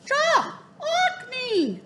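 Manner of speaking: high squawking bird voice; raven croak. Acted. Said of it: "the raven croaked".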